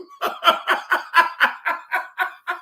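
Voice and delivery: breathy silent laugh; airy giggle. Real, not acted. Breathless laugh after story.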